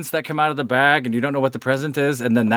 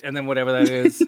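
usual speaking voice

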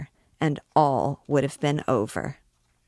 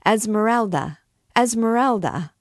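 crowd shout, higher, louder, falling; 6 semitones higher, 4 dB louder than usual. A narrator's reading. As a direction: hail her name across the court. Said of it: "a shout rose from the thieves".

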